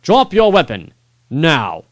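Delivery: high, loud order; king ordering the lord to drop his weapon; commanding call. Acted. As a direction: demand it with royal authority. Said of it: "he called out".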